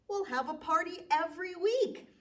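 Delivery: high, loud festive announcement; proclaiming. Acted. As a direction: announce it to everyone. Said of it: "the giant proclaimed".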